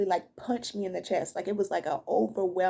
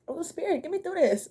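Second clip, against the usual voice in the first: high, tearful voice close to crying; pleading to Spirit through real tears; tearful plea; 9 semitones higher than usual. Real, not acted. Reader fighting tears, asking Spirit for strength, tearful. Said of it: "I started crying".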